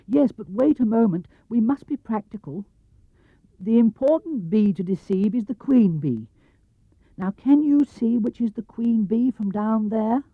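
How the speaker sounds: lightly high bear voice; a small bear explaining his plan; Pooh bear. Acted. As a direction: bear asking for a favour. Pooh voice held.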